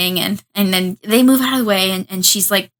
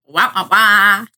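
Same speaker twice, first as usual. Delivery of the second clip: drawn-out mock trombone wail; comic wah-wah; 3 dB louder, slower than usual. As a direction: voice a slow cartoon sound effect. Imitates a comic sound effect.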